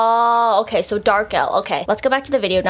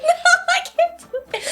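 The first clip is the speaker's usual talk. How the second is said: very high, laughing wail; laughing defeat. Real, not acted. Learner giving up on the accent, real laughter. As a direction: give up in high giggles.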